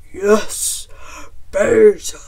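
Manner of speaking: loud, hearty bear voice; delighted bear. A narrator's reading. Bear approves Chicken's herbs, booming bear delight. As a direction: boom it out as a pleased bear cook.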